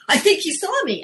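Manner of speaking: high put-on voice of a young girl telling a story; lightly hammed-up teen narrator; imitating a young storyteller. Shown as a demonstration. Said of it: "stories that go something like this".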